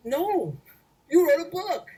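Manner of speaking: high, emphatic brush-off; insistent excitement. Retold or imitated. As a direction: wave the house away, thrilled about the book.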